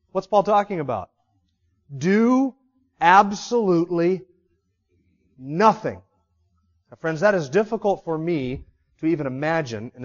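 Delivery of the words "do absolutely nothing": "Do" lifted, "absolutely" drawn out, "nothing" dropped; slow emphatic phrase. Shown as a demonstration. Preacher spelling out what nothing means. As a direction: space out "Do absolutely nothing" word by word. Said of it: "repeating the emphasized phrase".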